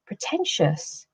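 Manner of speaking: quiet, slow, stung echo of "Pretentious?"; offended echo. A narrator's reading. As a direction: repeat "Pretentious?" softly, slowly, hurt and disbelieving. Narrator repeats Gran's word, offended.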